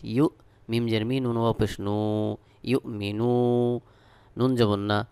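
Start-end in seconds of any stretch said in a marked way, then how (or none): none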